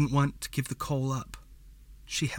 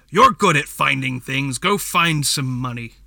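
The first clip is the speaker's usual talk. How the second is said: much louder, angry father's outburst; angry demand; 9 dB louder than usual. A narrator's reading. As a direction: raise your voice and order her out to earn. Drunk father throwing mug, demanding money.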